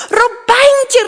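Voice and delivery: high, loud call to daughter; acted king crying out in alarm; alarmed shout. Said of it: "riep de koning". (geschrokken roepen)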